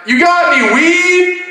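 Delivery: high, drawn-out put-on voice; shady fisherman. Man fishing asks a kid for weed, high drawl.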